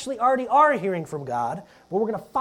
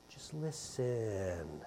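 slow hush; 8 semitones lower, 12 dB quieter, much slower than usual. Shown as a demonstration. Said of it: "Not to other people for a moment".

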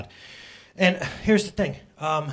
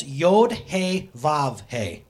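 Yod He Vav He read slowly, letter by letter; slow spelling out; much slower than usual. Shown as a demonstration. Pastor teaching congregation the Hebrew letters, slow and deliberate. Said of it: "So from right to left".

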